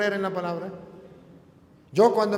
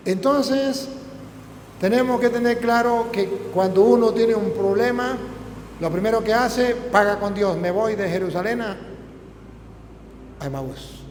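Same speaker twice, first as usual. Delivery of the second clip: louder, higher preaching push; preaching at full force; 4 semitones higher, 5 dB louder than usual. Acted. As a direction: drive the point home from the pulpit.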